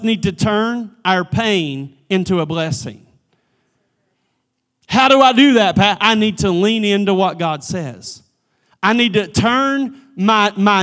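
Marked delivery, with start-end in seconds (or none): 4.9-6.0 a louder, higher eager ask; questioning listener. Retold or imitated. A churchgoer asking Pat how, called out louder. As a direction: call out like someone in the pews. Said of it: "congregation member asking".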